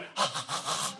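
a big unvoiced huff of breath; breath blown out. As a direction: blow hard right into her face. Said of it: "And I went".

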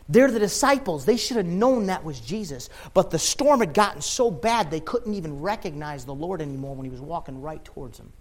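ordinary delivery

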